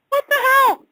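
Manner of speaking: "What the hell?" shot up high and loud; shocked exclamation. Real, not acted. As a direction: blurt out "What the hell?" squeaky with astonishment.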